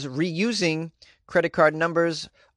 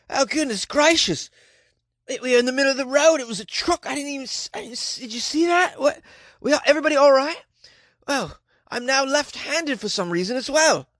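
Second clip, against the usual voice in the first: high, breathless, muddled voice after the crash, some lines rushed; hammed-up groggy survivor, accent fading; mock dazed crash victim; 9 semitones higher than usual. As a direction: act woozy and confused after the accident. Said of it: "I just imagine she's riding in a car".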